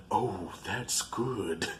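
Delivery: very soft, low, drawn-out relish; Bruce the shark. Retold or imitated. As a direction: savor it slowly, almost to yourself. Acts Bruce smelling blood.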